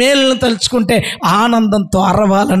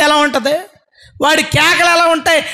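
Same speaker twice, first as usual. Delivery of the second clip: impassioned build; 7 semitones higher than usual. Real, not acted. Builds towards the healed man's cries.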